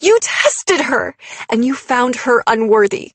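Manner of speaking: loud, high, anguished outburst; shaken fury. A narrator's reading. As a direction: let the accusation burst out, overwrought. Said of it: "My voice shook".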